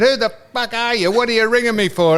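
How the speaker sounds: high-pitched mock outburst; retold irate challenge. Telling the caller how he first answered him, high mock irritation. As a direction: imitate yourself snapping at a stranger.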